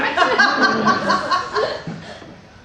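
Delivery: high, loud burst of laughter; nervous relieved laugh. Acted. Father relieved the stranger was a random weirdo, loud high laugh.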